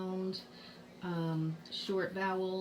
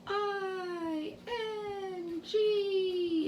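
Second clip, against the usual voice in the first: high, drawn-out letter names; sing-song letters held long and high; imitated spelling-out; 12 semitones higher, much slower than usual. Students spelling out a suffix to their teacher, imitated high and drawn out. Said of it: "they say to me".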